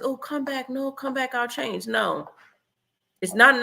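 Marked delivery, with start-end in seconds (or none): 0.0-1.9 higher, softer mock pleading. Dumped partner begging to be taken back, mock plea. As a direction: imitate a begging ex asking them back. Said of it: "when a guy says".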